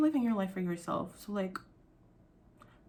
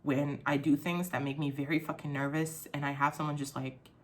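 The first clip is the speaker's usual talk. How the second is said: usual speaking voice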